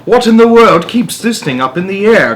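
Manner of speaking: put-on high croaky old woman's voice; croaked. A narrator's reading. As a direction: croak it out like a suspicious old granny. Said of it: "croaked Grandma Josephine".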